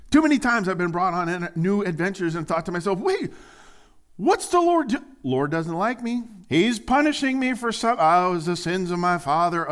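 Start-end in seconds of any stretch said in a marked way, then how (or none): 3.0-9.7 high, loud panicky inner voice; the pastor voicing his own panicked thoughts, high and loud; mock panic. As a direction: fret like you're being punished.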